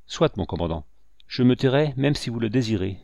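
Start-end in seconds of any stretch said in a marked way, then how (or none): none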